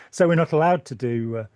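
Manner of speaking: ordinary talk, nothing marked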